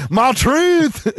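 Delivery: high, odd put-on accent on "My truth". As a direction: say "My truth" in a sudden mock accent. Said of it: "Where did this accent come from?".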